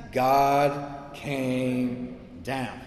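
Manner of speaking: God came down stretched out very slowly. Shown as a demonstration. Pastor correcting a misreading, saying the key words very slowly. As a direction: let each word of God came down hang, very slowly.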